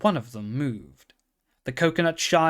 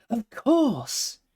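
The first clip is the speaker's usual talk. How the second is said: high, bright-eyed pony voice; a young pony struck by an idea; excited pony; 11 semitones higher than usual. A narrator's reading. Pony to himself, thrilled at his idea.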